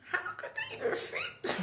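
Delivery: high laughing astonishment; incredulous. Retold or imitated. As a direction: squeak it out, amazed they fit. Kids escaped through window.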